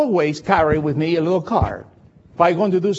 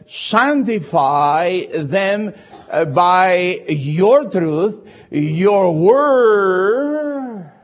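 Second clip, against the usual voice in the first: slow, louder solemn reading; scripture reading; 3 dB louder, much slower than usual. Acted. Preacher reading John to the congregation, solemn. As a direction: read the verse with weight.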